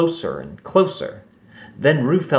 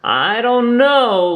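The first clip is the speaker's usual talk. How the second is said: slow, high and unsure; a shy little voice hesitating; hesitant; 12 semitones higher, 4 dB louder, slower than usual. A narrator's reading. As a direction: hold back, unsure of yourself. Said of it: "Lumpy hesitated".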